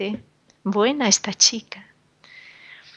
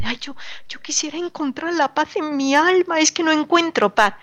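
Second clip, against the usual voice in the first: mock troubled seeker; 7 semitones higher, faster than usual. Preacher voices a troubled seeker.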